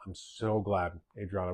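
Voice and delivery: usual speaking voice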